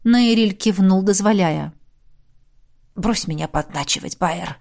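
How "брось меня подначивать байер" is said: hoarse, soft rasp. A narrator's reading. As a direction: croak it out hoarsely. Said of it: "прохрипел он вслух". (хрипло)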